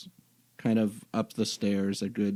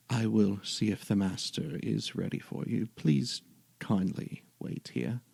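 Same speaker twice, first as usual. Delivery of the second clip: creepy butler; 7 dB quieter than usual. Acted. Butler speaks before leaving.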